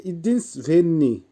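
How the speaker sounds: teacher modelling ইদিনসভেন্নি; careful pronunciation demo. Shown as a demonstration. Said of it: "উচ্চারণ হবে". (ইদিনসভেন্নি-র স্পষ্ট উচ্চারণ)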